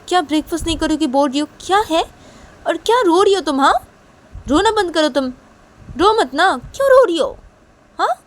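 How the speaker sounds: high-pitched, exasperated mum voice; scolding mother. Acted. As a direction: act out a mother telling off a crying child. Voices mother scolding crying girl.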